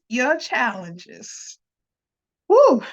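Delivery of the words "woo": high, loud whoop; excited cheer. Real, not acted. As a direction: cheer it out with joy. Cheering after embracing challenges.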